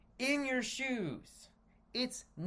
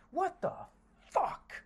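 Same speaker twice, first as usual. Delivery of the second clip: exasperated; 8 semitones higher than usual. Real, not acted. Exasperated rant about trickle-down economics.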